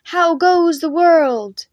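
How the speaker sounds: loud, high, drawn-out call; ranger call. Acted. Knights giving the ranger call, loud. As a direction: call it out across the hall. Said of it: "They gave the ranger call".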